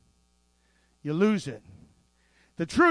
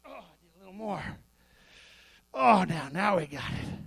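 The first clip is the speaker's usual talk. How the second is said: hammed-up effort under a heavy weight; mock strain; 6 semitones lower, faster than usual. Preacher pretending to lift a heavier weight, low groaning strain.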